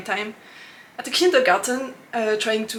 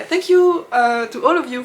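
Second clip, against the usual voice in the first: excited thanks; 3 semitones higher, 9 dB louder than usual. Real, not acted. Thanking her viewers.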